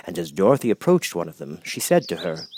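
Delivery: usual speaking voice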